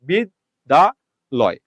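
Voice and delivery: "বিদ্যালয়" very slowly as bid, da, loy; syllable division. Shown as a demonstration. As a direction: pause between the three syllables of "বিদ্যালয়", closing on loy. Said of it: "তাহলে এই যে লয়".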